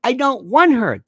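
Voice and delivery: high, loud exasperated retort; irritated refusal. Retold or imitated. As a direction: act out someone flatly refusing, high and insistent. Retelling an angry refusal as quote.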